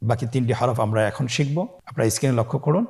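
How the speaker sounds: ordinary talk, nothing marked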